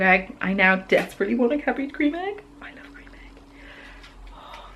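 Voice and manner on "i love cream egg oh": whisper. Real, not acted. Whispered aside about chocolate.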